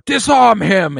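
high, loud mock command; imitating a sudden urgent order; retold shouted order. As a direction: shout it like an alarmed official.

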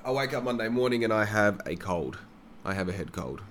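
ordinary talk, nothing marked

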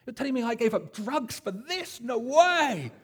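high, loud incredulous outburst; disbelieving cry, going up and loud. Retold or imitated. Wimber to the church leader, hammed-up high incredulous outburst. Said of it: "John Wimber was incredulous".

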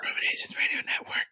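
fast, breathy sexy whisper. Shown as a demonstration. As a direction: purr it out as a whisper. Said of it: "Daddy's got a sexy whisper".

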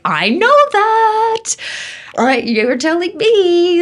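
very high, loud, drawn-out squeal; dramatised, exasperated agreement with a doubter; exasperated retort. Retold or imitated. Daughter answering her sceptical analytical dad, exasperated retort.

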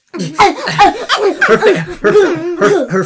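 words break into high laughter; laughing. Real, not acted. Narrator laughing while describing.

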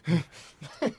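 put-on halting African accent with audible in-breaths; badly faked African accent, gasping between words; mock African accent. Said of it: "He's probably got a wild African accent".

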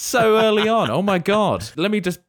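high-pitched, thrilled disbelief; real amazed excitement. Real, not acted. Host amazed at player's early guess, thrilled. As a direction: reel from an astonishing lucky guess.

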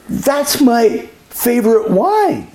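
high, slow, delighted mock squeal; delighted seatmate. Seatmate spotting the label, high slow delighted voice. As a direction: imitate her thrill, drawn out and high.

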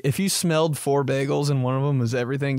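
usual speaking voice

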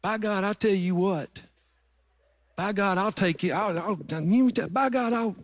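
mock country tough talk, tripping over words. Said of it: "redneck truck driving friends would come around".

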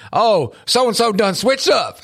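high, slow, hammed-up "done switched up"; dad needling his son with the son's own slang; teasing mock slang. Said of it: "I'll mess with him".

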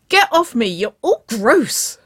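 loud, high squealed complaint; squealing protest. A narrator's reading. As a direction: squeal in protest while being grabbed. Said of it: "Waverley squealed".

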